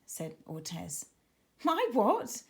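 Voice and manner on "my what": startled, higher pitched "My what?"; puzzled yelp of disbelief; surprised exclamation. A narrator's reading. Girl baffled when told to grab her octopus, surprised. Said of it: "exclaimed Tara".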